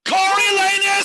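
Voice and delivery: very high, loud call; shouting a name. Acted. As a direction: yell his name over the tumult. Crowd calls in the brawl.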